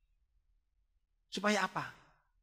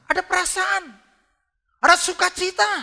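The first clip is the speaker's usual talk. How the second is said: much higher, louder joyful call; exultant preaching; 11 dB louder than usual. Acted. Preacher proclaiming joy to the congregation, high and loud. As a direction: lift them into joy.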